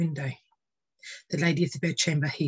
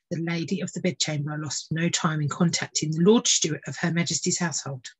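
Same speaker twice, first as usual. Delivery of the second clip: usual speaking voice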